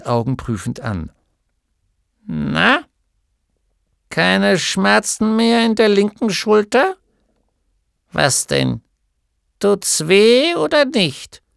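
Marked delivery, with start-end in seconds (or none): 2.2-11.4 put-on high, louder, impatient little old woman; brusque gnome healer. A narrator's reading. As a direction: prod the patient gruffly. Healer gnome questions patient. (Heilerin fragt Atreju ungeduldig, höher und lauter)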